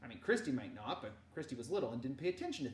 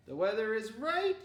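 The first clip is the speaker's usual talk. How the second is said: high, loud motherly request; mother's voice; 7 semitones higher, 7 dB louder than usual. Acted. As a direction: ask sweetly but firmly. Mama voiced, asking.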